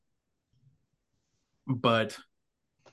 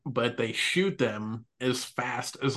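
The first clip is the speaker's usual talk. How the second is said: ordinary talk, nothing marked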